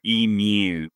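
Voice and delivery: emu drawn out slowly in a put-on Australian accent; slow, hammed-up Australian emu; mock Australian accent. Shown as a demonstration. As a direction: stretch out emu like a proud Aussie. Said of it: "completely authentic Australian accent".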